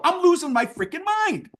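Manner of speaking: high exasperated outburst; freaking out. Real, not acted. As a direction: let the frustration spike up high. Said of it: "I'm freaking out now".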